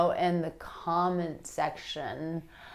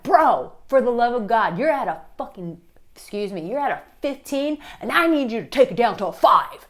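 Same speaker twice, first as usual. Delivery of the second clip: exasperated plea; 4 semitones higher, 6 dB louder than usual. Retold or imitated. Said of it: "you're like".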